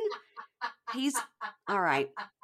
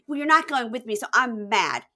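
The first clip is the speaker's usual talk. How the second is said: higher, louder mock huff; mock huffy; 5 semitones higher, 5 dB louder than usual. Daughter leaving mom after an argument, mock huffy. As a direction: imitate a daughter stamping off. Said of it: "So she stamps off".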